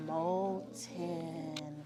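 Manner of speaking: very slow, stretched-out word; drawn-out syllables, sinking like a melting body. Shown as a demonstration. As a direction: let it ooze out as if melting. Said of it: "pretend to melt your body".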